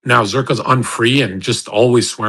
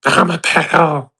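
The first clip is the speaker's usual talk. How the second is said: mock scream; 7 semitones higher, 5 dB louder than usual.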